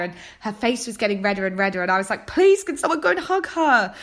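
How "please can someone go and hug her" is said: higher, louder pleading call to hug her. Retold or imitated. A TV viewer begging someone to hug the crying baker, pleading. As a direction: beg someone to go and comfort her.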